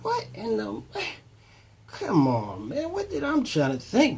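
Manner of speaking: stunned disbelief. Retold or imitated. Driver hears of a warrant, startled high disbelief. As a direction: replay the shock of hearing about a warrant.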